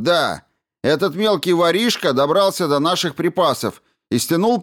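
high, loud furious outburst; angry shouting. A narrator's reading. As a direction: yell it in his face. Eddy shouting in a quarrel.

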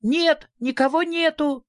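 put-on high, thin little girl's voice; small squeaky refusal from behind the door. A narrator's reading. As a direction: pipe up in a tiny high voice. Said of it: "услышали тоненький голосок".

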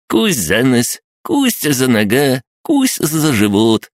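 high, gleeful biting chant; crab gnome voice. Acted. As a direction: tease like a gleeful little imp. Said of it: "приговаривал крабогном".

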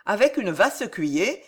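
ordinary delivery, nothing marked

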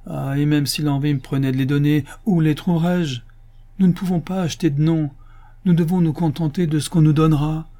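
high plaintive complaint; whining. A narrator's reading. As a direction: protest helplessly, pitch kept up. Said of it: "pleurnicha Absalom". (plainte geignarde, voix haute)

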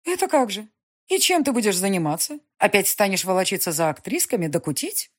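властная графиня, громче и выше; мать поучает сына, с напором; напористая мать. Acted. Mother voiced. (властная мать, повышенным тоном)

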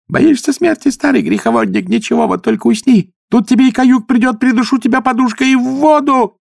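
very high shrieking threats; shouting. A narrator's reading. Possessed woman shrieking death threats at her father. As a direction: scream it wildly. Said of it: "продолжала выкрикивать свой монолог".

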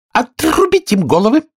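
high, imperious death sentence; imperious order. A narrator's reading. Queen sentences the gardeners, high and imperious. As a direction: pronounce it high and commanding.

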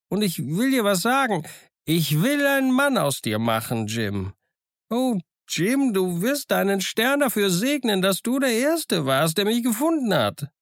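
put-on high, fervent old hermit making promises; overjoyed castaway praising his luck. Castaway promises Jim riches.

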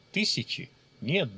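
plain reading voice

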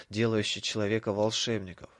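ordinary delivery

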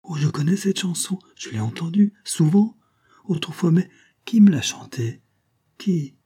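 high, breathy excited cry; beaming, almost gasping it out; radiant exclamation. A narrator's reading. Geneviève remembering the song, radiant cry. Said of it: "rayonnante, s'écria".

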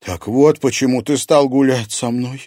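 usual speaking voice

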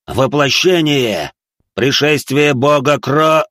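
slow, loud, drawn-out invocation; battle cry. A narrator's reading. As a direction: roar the spell into the ground. Mark invokes his technique.

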